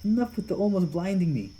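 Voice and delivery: high, loud, squinting protest; put-upon yelp at the blinding lights; exasperated complaint. Acted. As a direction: gripe about the glaring light.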